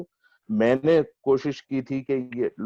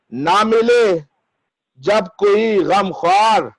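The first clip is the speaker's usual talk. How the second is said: measured, higher mushaira declamation with lengthened vowels; ghazal recitation; 7 semitones higher, 5 dB louder, slower than usual. Acted. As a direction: recite the verse grandly, drawing out each phrase.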